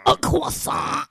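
high, loud, drawn-out mock French; hammed-up French climax, loud and slow; mock French accent. Co-host mocking French people in ecstasy, exaggerated French accent. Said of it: "You think that's how French people come?".

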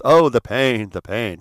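a hint of a campy TV coward moaning; mild mock lament of a campy villain; Doctor Smith. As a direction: lightly ham up the sci-fi whiner. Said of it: "Doctor Smith".

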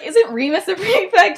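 high, loud indignant question; offended. Retold or imitated. Girl barred from the meeting, acting offended. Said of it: "And she's, like, offended".

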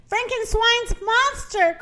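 high, slow mock cry of alarm. A narrator's reading. Rooster naming the monster, crying out in alarm. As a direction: draw it out high without real volume. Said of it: "cried the rooster".

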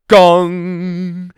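loud, ringing gong with held hum; imitated gong. A narrator's reading. Clock tower striking in the street, loud imitated gong. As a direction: boom out a clock chime. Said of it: "they heard a gonging noise".

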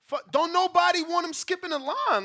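ordinary delivery

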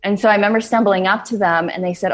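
usual speaking voice

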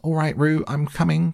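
put-on high, sustained boyish call; high singing-out to reassure. A narrator's reading. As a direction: call across a distance, pitch held high. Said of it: "called Christopher Robin".

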